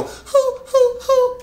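high falsetto hoo; falsetto hoots. Shown as a demonstration. Coach repeating the easy falsetto hoot. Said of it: "If I go".

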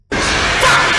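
loud, harsh scream of a swear word; startled yell. Real, not acted. Player startled by the horror game, startled yell. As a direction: yell out as if jumped.

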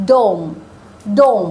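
ডোম with a long o vowel, clearly modelled; teacher says ডোম slowly with an o sound; pronunciation demo. Shown as a demonstration. Teacher modelling dome for students, clear o vowel. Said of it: "প্রথম ওয়ার্ডটা কি হচ্ছে".

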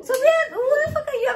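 loud, high-pitched incredulous scolding; mock-indignant challenge. Real, not acted. Mother challenging child's vet theory, loud mock indignation. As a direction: challenge them loudly, voice shooting up.

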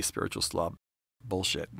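ordinary talk, nothing marked

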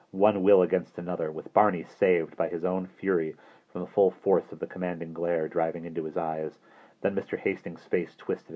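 usual speaking voice

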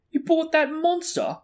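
high, loud, incredulous question; appalled disbelief. A narrator's reading. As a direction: gape at it in horrified astonishment. Ron appalled, mouth hanging open.

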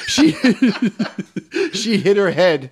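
high, laughing through the words. Acted. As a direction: giggle out the lame excuse. Laughing retelling of the excuse.

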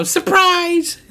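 very high playful gotcha; spring the reveal on the viewers; surprise reveal. Real, not acted. Revealing the camera is filming, high playful surprise.

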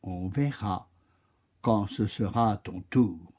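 low, soft, unruffled oak voice; patient and imperturbable. Acted. The oak calmly turns the chameleon away, low and soft. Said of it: "répondit le chêne imperturbable".

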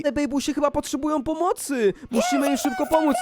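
high-pitched, pressing concern; urgent alarm. Pretend. Player rushing to rescue the babies, urgent alarm. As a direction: raise the pitch in playful urgency.

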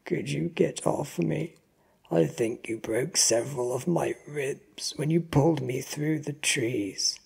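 hurting, strained victim; pained. A narrator's reading. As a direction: plead as if your ribs hurt. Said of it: "He grimaces in pain".

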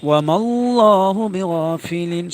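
loud chanted recitation, ফিলিন drawn out; Quran recitation. Shown as a demonstration. Teacher reciting for the class, loud chanted verse.

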